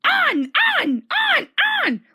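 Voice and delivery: very high, loud jeering truck voices; mocking trucks. A narrator's reading. Naughty trucks laughing as they push James on. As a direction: taunt him, cackling. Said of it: "laughed the trucks".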